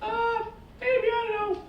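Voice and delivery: high, small, hesitant voice; soft squeaky uncertainty; child Jesus. Retold or imitated. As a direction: answer like a timid little kid. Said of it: "And Jesus goes".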